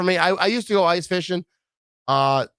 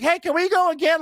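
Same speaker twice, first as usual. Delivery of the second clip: put-on much higher eager kid voice; 13 semitones higher than usual. Kids begging to ride again, eager child voice. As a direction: ask like an excited child. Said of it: "They're like".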